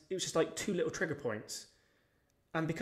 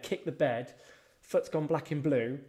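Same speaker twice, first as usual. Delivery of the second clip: normal talking voice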